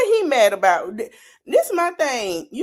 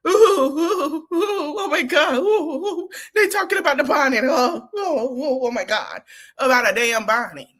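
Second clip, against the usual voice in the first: mock panic; 4 semitones higher than usual. Mocking a crying woman panicking over the bonnet. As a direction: ham up her freakout over a bonnet.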